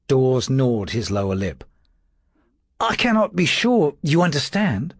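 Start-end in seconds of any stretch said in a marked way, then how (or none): 2.8-4.9 high, thin timid clerk's voice; a frightened servant trying to sound firm; nervous little secretary. A narrator's reading. Dawes answering his lordship's questions, high and nervous.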